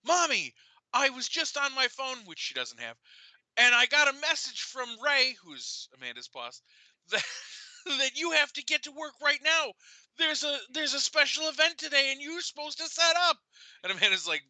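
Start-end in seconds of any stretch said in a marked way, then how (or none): 0.1-2.2 put-on high child voice; little girl voice. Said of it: "Marion said". 3.6-5.4 put-on high child voice; little girl voice. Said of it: "Marion said". 7.1-13.4 put-on high child voice; little girl voice. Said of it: "Marion said".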